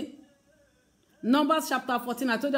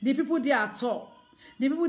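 usual speaking voice

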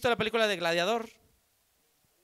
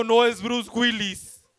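slow correction; much slower than usual. Retold or imitated. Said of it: "ahí vino de pendejo".

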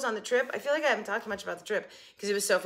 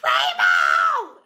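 loud mock yell; family mimicking her scream; imitating the teasing shout; 8 semitones lower, 9 dB louder than usual. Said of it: "they were making fun of me 'cause I screamed rainbow".

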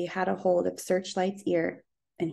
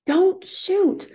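high, loud desperate shout; a boy's panicked high yell; frightened yell; 13 semitones higher, 7 dB louder than usual. A narrator's reading. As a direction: scream it to stop the gun. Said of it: "Little Willie yelled".

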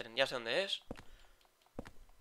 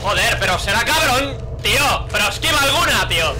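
raging shout, higher and louder; furious yelling; 3 semitones higher, 9 dB louder than usual. Real, not acted. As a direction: scream at the enemy in real fury. Repeatedly hit by an enemy.

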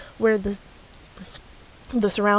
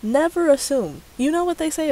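scolding shout; 8 semitones higher, 4 dB louder than usual. Real, not acted. Scolding commenter.

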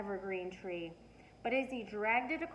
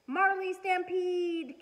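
slow, much higher, loud alarm yell; 9 semitones higher, 7 dB louder, slower than usual. A narrator's reading. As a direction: raise the alarm, drawn out. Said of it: "Cassie yelled".

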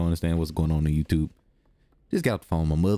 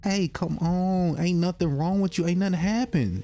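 much higher, silly pretend voice for the hungry kid; squeaky character voice; 10 semitones higher than usual. Gamer voicing the hungry game character, high and whiny. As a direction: play the little guy whining about his stomach.

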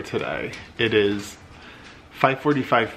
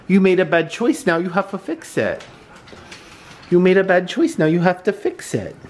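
scolding the dog; 9 semitones higher than usual. Real, not acted.

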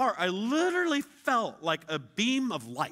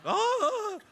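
mock sob; 3 dB louder than usual. Starbucks crowd crying.

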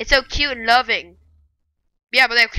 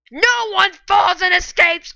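slow, high, shouted menace; hammed-up evil proclamation; villain taunt; 5 semitones higher, slower than usual. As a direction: gloat like a cartoon villain. Taunting fleeing enemy.

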